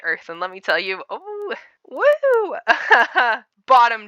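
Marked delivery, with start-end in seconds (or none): 1.1-2.5 very high drawn-out "woo"; mocking whoop. Real, not acted. Exclaiming over her self-praise. 2.6-3.4 loud, high burst of laughter; mocking laugh. Real, not acted. Laughs at her own jab.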